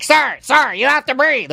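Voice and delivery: nurse calling out to wake a drowsy patient; nurses yelling. Retold or imitated. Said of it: "They're, like, yelling at me".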